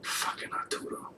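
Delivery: hissed contempt under breath; fed-up muttered curse; disgusted whisper. Real, not acted. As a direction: whisper his name with loathing. Disgust at character.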